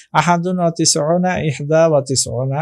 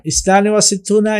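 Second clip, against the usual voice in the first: quick recap repeat; 6 semitones higher, faster than usual. Shown as a demonstration. Teacher rushing through the Arabic number for students, fast. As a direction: rattle off ইসনানে ওয়া সিত্তুনা fast, slightly higher. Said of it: "teacher quickly repeats the number".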